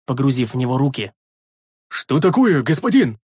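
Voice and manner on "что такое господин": mildly alarmed, raised question; alarmed shout. A narrator's reading. Big man to his master, alarmed. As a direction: call out with a touch of alarm. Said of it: "встревоженно крикнул здоровяк".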